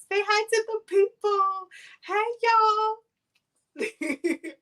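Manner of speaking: high, loud cooing to a dog. Real, not acted. Owner getting her dog to greet viewers, high baby talk. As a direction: coo it high to the pet.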